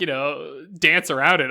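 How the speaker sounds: usual speaking voice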